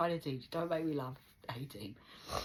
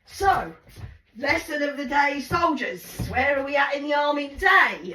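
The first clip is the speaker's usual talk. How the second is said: high, loud parade-ground call; army instructor shouting to soldiers; drill sergeant; 8 semitones higher, 9 dB louder than usual. Acted. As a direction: address the troops at full volume.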